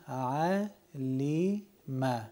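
ع لی م drawn out, syllable by syllable; very slowly. Shown as a demonstration. Teacher asking students to read slowly. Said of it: "بسیار و آهستگی تلفظ بکنین".

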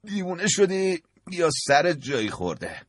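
صدای زیر و متفاوت پل از بلندگو; با تعجب مسخره از پشت تلفن بپرس. Acted. (پل پشت بلندگوی تلفن با تعجب، زیر و متفاوت)

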